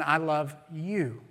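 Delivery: "you" lifted and weighted after "love"; stress on YOU. Shown as a demonstration. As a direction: land firmly on the word "you". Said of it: "you emphasize each different word".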